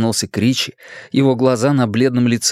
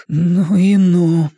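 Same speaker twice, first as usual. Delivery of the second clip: slow, higher, quavering exclamation; trembling voice; 6 semitones higher, 4 dB louder, much slower than usual. A narrator's reading. Richie shaken by the thought, voice trembling. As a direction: draw it out with a tremble. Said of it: "с дрожью в голосе вымолвил он".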